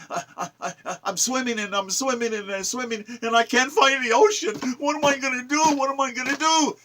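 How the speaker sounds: high, far-off fretful fish voice; lost little fish. Retold or imitated. Poet voicing a lost fish that can't find the ocean, high and fretful. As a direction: squeak it out like a fish in a panic.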